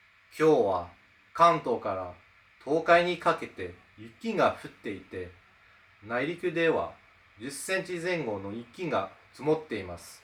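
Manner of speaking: low, soft, careful Japanese recitation in standard Tokyo pitch; correct Tokyo dialect. Shown as a demonstration. Student reading a sentence in correct Tokyo pitch accent, careful recitation. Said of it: "according to the correct Tokyo dialect".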